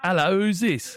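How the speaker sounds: hammed-up English caller; poor English accent. Prank caller rings a cafe, poor English accent. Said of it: "disregard the piss poor English accent".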